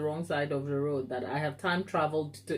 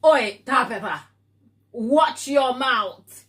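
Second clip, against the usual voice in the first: Oi, Tabitha hammed up as a cheeky British telling-off; mock British scold; 5 semitones higher, 6 dB louder, slower than usual. Host jokingly scolding Tabitha in chat, mock British telling-off. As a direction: playfully tell her off, slowing to a laugh.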